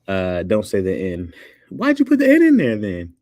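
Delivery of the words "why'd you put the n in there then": loud, high-pitched protest; exasperated challenge. Real, not acted. Streamer disputing spelling with chat, high exasperated protest. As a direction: throw it back at them, voice jumping way up.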